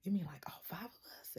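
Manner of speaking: high, stunned disbelief, partly breathed; a man who can't believe his luck; amazed stranger. Retold or imitated. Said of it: "one gentleman was like".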